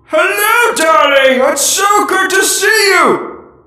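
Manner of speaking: high, loud diva greeting; soprano voice. Acted. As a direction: gush like an operatic diva. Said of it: "The really high soprano of our group".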